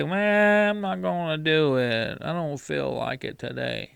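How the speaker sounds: drawn-out mock whine, sinking away; mock whiny excuse. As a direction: imitate someone moaning their way out of it. Mimicking someone making lazy excuses.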